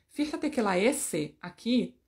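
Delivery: ordinary delivery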